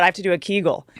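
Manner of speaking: ordinary delivery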